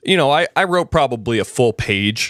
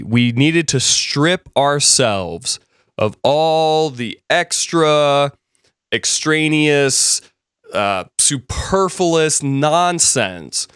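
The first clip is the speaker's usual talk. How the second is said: slow, with each word stretched out; slowed, deliberate emphasis on each word; slow emphatic list; 3 semitones higher, much slower than usual. Real, not acted. Lecturing emphasis, drawn out.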